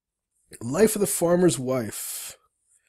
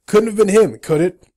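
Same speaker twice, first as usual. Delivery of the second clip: louder, higher disbelieving retake; emphatic disbelief; 5 semitones higher, 10 dB louder than usual. Acted. Startled player doubting the creature spoke, loud disbelief. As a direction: say it loud and incredulous. Redoes the line with force.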